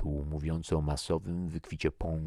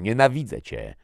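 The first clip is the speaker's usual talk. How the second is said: loud, raised outburst of hatred; yelling; 7 semitones higher, 9 dB louder than usual. A narrator's reading. Soldier about to fire on his commander, yelling. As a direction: hurl it angrily at your opponent. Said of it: "wydarł się Monroe".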